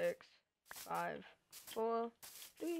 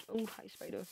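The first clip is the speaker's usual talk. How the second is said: ordinary delivery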